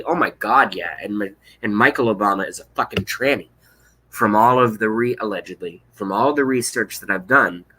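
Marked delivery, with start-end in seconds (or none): none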